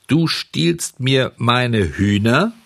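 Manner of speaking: langsam, lauter und höher; empört anklagen; wütend. Acted. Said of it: "sagte der Bauer wütend". (der Bauer klagt Bengele wütend und langsam an)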